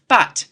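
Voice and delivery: a sharp, loud but; emphatic. A narrator's reading. Professor insisting to the men, emphatic. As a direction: stress the word but hard. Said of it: "the professor's words became emphatic".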